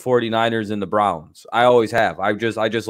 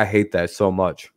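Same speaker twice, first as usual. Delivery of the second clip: ordinary talk, nothing marked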